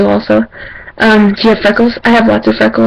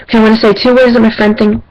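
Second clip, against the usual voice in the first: ordinary delivery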